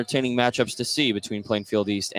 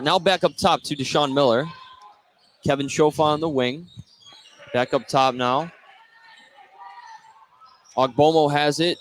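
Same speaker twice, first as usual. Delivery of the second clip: ordinary delivery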